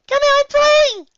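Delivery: very high, slow squealed call; loud squeak. A narrator's reading. Pony coaxing a shy friend to play, loud squeak. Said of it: "I squeak".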